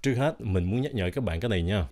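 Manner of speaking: ordinary talk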